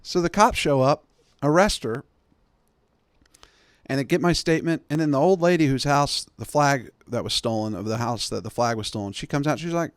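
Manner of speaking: ordinary delivery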